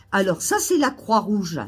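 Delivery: ordinary talk, nothing marked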